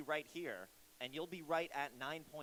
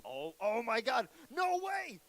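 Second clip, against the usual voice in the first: amazed; 7 semitones higher, 7 dB louder, slower than usual. Real, not acted. Amazed by juggling talent.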